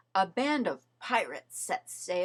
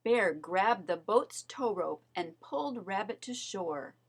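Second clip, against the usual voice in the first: plain reading voice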